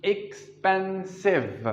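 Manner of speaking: Expensive drawn out slowly, each vowel sound apart; teacher spelling out Expensive by its vowel sounds; slow syllable demo. Shown as a demonstration. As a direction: stretch Expensive so every syllable is heard. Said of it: "No, the vowel sounds".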